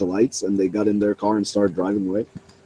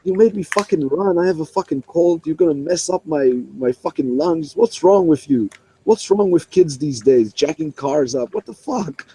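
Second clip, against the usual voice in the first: higher-pitched furious rant of a sick cop; imitated angry cop; 7 semitones higher than usual. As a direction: rage at the kid who made you run. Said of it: "he was so angry".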